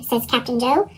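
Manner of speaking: usual speaking voice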